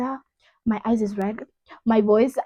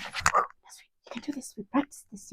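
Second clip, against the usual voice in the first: breathy, barely voiced reassurance; hushed, retold muttering to self; whispered self-pep talk; 12 dB quieter than usual. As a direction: whisper encouragement to yourself before going on.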